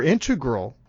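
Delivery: "integral" punched on IN, IN-tuh-grul; stress on first syllable. Shown as a demonstration. Offering the other pronunciation, "integral" stressed on IN. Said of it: "That's the other pronunciation".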